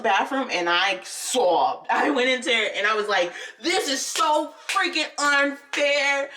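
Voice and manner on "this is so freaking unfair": higher, slow, drawn-out indignant wail; mock a furious child's tearful protest; angry sobbing child. Girl protests alone in the bathroom, angry. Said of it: "I was so angry".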